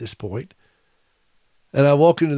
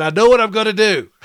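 higher and louder, hands-in-the-air announcement; wife's eager declaration; 8 semitones higher, 6 dB louder, faster than usual. Retold or imitated. Wife in hospital bed announcing her plan, higher and louder. Voicing his wife's sudden idea.

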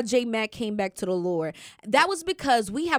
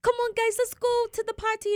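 higher-pitched imitation of an excited roommate; eager party girl; 11 semitones higher than usual. Roommate coaxing friends to a party, lightly mimicked.

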